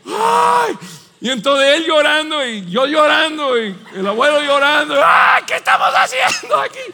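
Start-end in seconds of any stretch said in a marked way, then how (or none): 0.0-0.8 high, loud frightened yelp; scared gasp. Retold or imitated. Father retelling his own fright on the ride, a high yelp. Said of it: "Yo decía". 5.0-6.8 very high, loud mock terror; panicked shout. Family terrified in the dark ride, high panicked shout.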